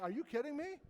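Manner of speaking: high, rising disbelief; incredulous reaction. Retold or imitated. Geologist finds his local quarry in a textbook, incredulous. Acting out his astonishment at discovery.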